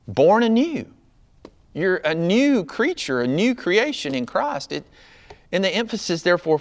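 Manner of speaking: high, loud, emphatic sermon delivery; impassioned preaching. Real, not acted. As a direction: drive each point home from the pulpit, pitched high. Preaches at full force.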